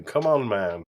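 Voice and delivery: ordinary talk, nothing marked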